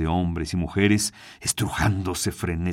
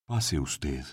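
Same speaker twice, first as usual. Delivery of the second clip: quiet; 5 semitones lower, 8 dB quieter than usual. A narrator's reading. Employee invites client in.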